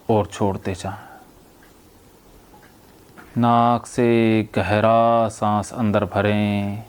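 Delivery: slow, low hypnotherapist's voice with long pauses; hypnotic induction. Real, not acted. Therapist guiding a patient to breathe and relax, hypnotic induction. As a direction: guide them into relaxation, drawing words out, softening at phrase ends.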